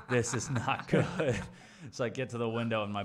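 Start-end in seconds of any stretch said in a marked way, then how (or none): 0.1-1.3 slow, loud words through laughter; louder, higher, laughing realization; amused dismay. Retold or imitated. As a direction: crack up while drawing out mock dismay. Retold dismay at slowing down.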